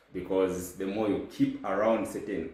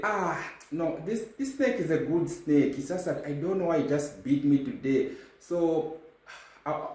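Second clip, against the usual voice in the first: high earnest mock naivety; hammed-up gullible voice; naive pleading; 6 semitones higher than usual. Mock naive snake owner.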